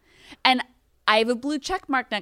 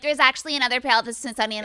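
rapid-fire dismissive imitation; mocking know-it-all; 2 semitones higher, much faster than usual. Podcaster imitating message senders, mocking and rapid. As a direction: rattle off a corrector's message. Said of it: "people being like".

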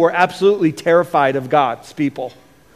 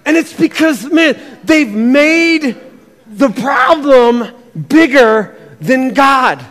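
ordinary delivery

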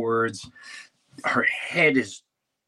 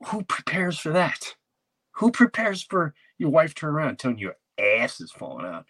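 exasperated disbelief; 7 semitones higher than usual. Retold or imitated. Comic's own exasperated retold reaction.

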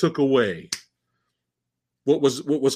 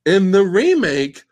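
ordinary talk

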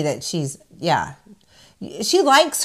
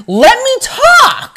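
high, loud, slow mock outburst; mock angry demand; 9 dB louder, much slower than usual. Host imitating Kyle snapping at Sutton, high loud mock demand. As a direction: demand the floor in a shrill mock. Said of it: "She's like".

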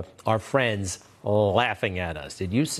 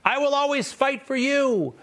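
high mocking politician voice, pitched way up; sarcastic high-pitched candidate voice; mocking politician promise; 13 semitones higher than usual. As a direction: lampoon an empty campaign pledge, high. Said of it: "they always say".

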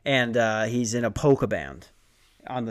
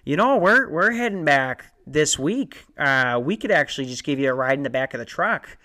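imitating a Chicago mover from the movie; Chicago accent impression. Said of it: "the guy in the movie sounded like this".

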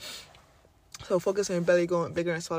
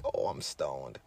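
stoned voice; 7 dB quieter, slower than usual. Retold or imitated. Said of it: "you're gonna feel like".

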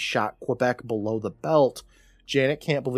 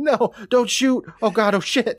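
panicked mock yell; 9 semitones higher, 4 dB louder than usual. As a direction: cry out in hammed-up panic. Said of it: "races down the stairs yelling".

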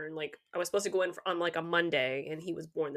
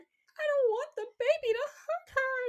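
very high-pitched mock kid voice; imitated worried child. Mom retelling child's worry about the baby, imitated high child voice. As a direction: plead like a little kid, high and exaggerated.

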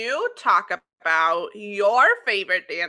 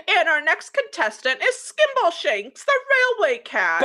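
higher-pitched contestant introduction; show announcer; 9 semitones higher, 4 dB louder than usual. Acted. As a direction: announce the act like a host. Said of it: "and then they're like".

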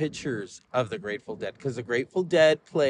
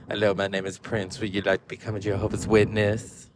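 low-pitched celebrity impression at the doorstep; 7 semitones lower than usual. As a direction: introduce yourself in a deep, smooth put-on voice. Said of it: "can you just imagine him at your door".

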